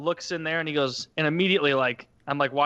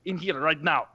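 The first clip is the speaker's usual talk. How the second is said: put-on higher and softer, apologetic Eastern European accent; the repairman apologizes for the heat; 6 semitones higher than usual. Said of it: "that Eastern European accent".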